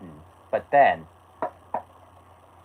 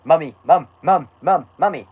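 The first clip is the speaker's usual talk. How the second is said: high, repeated nagging calls; pestering child impression; 5 semitones higher, 4 dB louder than usual. Shown as a demonstration. Child keeps calling for mummy, pestering mock-child voice. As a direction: pester insistently in a higher voice.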